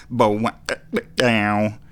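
vocalized twangy guitar run; mouthed guitar lick. Retold or imitated. Radio host showing listeners the lick he can't do, mouthed guitar noise. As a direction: imitate a guitar riff with the mouth.

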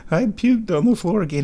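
high, fretful pet voice; voicing the worried cat. Owner voicing her cat's worry in a high voice. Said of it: "She's like".

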